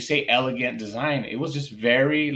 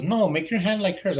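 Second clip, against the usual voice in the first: lightly voiced kid, higher pitch; correcting student; 8 semitones higher than usual. Student corrects teacher testing the prototype, insistent. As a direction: imitate a child setting the teacher straight.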